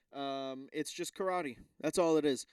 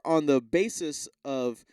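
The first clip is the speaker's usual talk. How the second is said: ordinary talk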